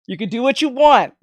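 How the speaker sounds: ordinary talk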